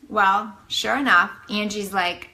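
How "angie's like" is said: ordinary delivery, nothing marked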